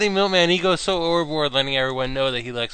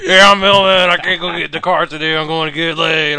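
loud, higher-pitched mocking imitation; Milkman; 4 semitones higher, 8 dB louder than usual. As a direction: bellow a hammed-up boast to tease him. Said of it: "That's just the way you talk".

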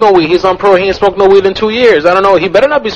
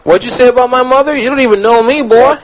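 offended southerner; 7 semitones higher than usual. Acted. Offended prank voice continues.

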